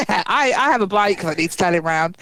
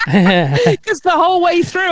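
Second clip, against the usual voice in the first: laughing; 10 semitones higher, 3 dB louder, slower than usual. Real, not acted. Laughing retelling.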